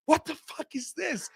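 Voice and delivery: high-pitched, baffled retelling; bewildered disbelief. Retold or imitated. Novice to partner after hearing rivals, acted bewilderment. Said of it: "me and my partner are just like".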